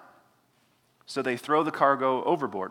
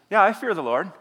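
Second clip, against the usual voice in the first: higher, louder, offhand mock piety; breezy mock claim; 6 semitones higher, 5 dB louder than usual. Shown as a demonstration. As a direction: toss it off like a hollow boast. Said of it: "you're supposed to sort of chuckle".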